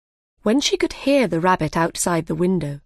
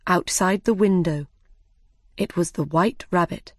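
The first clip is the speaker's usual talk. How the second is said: plain reading voice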